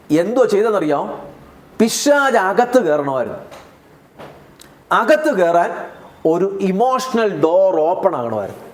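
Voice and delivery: normal talking voice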